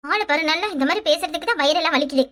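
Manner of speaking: ordinary talk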